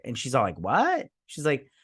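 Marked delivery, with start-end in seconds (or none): 0.6-1.1 mock surprise. Queen reacts to proposal.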